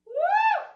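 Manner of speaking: a loud, very high "woo" of delight; high excited whoop. Real, not acted. Fitness instructor cheers on class, loud high whoop. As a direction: whoop like the set just peaked.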